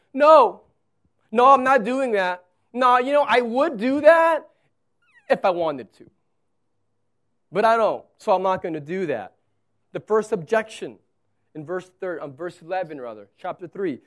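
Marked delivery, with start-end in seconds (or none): 0.1-9.3 loud, higher protesting Moses; Moses refusing. Acted. Preacher voicing Moses refusing God, loud and stubborn. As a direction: dig in and refuse, loudly. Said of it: "five times Moses says".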